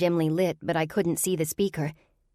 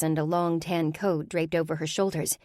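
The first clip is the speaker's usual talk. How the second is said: usual speaking voice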